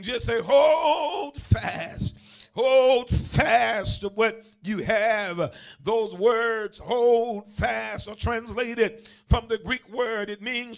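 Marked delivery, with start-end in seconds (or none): 0.4-5.5 big, drawn-out preacher's cry. Shown as a demonstration. Preacher showing the congregation how to say it, preacher voice. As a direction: belt it out in your preacher voice. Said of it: "put your preacher voice on".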